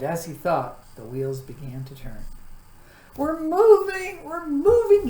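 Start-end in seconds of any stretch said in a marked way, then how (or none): 3.2-5.1 high, loud, delighted cry; happy shout. A narrator's reading. Milo cheers as the car starts, loud and joyful. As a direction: shout for joy as the car moves. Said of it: "he shouted happily".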